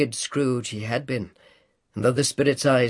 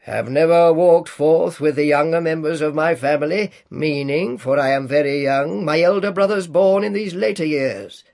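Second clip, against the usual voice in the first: louder, resonant spirit voice; echoing ethereal energy being; 3 semitones higher, 6 dB louder than usual. A narrator's reading. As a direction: announce yourself as a giant spirit. Ghost speaks.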